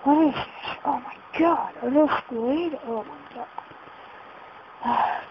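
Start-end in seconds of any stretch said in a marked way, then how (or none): 2.9-5.2 breathless slow whisper of alarm; awed whisper. Real, not acted. Gamer whispering, frightened by a squid.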